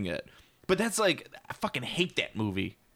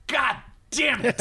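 high, drawn-out mock curse; hammed-up slow exasperation, pitched up; retold outburst; 12 semitones higher, 3 dB louder, slower than usual. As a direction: replay the frustrated cry, stretched out. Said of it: "I was just like".

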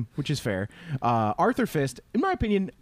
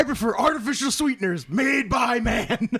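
high, hammed-up film line; high-pitched comic quoting; mock movie-quote outburst; 8 semitones higher than usual. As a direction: belt out the quote in a high put-on voice.

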